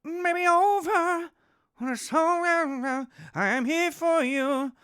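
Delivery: high, slow mock-tender Salah voice; a put-on high voice for Salah's farewell; imitating Mo Salah. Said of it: "at one point he was".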